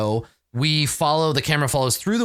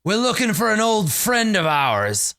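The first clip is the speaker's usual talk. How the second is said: high-pitched put-on ruffian voice; menacing pirate leader; 9 semitones higher than usual. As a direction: announce the search with swaggering menace. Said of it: "says the dead-eyed man".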